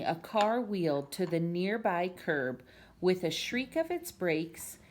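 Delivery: ordinary delivery, nothing marked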